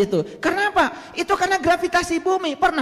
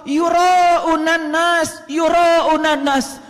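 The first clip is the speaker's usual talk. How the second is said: very slow, drawn-out chanted Arabic; Quran recitation; 2 semitones higher, much slower than usual. Acted. Preacher chants the verse phrase twice, very slowly. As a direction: stretch each syllable like tilawah.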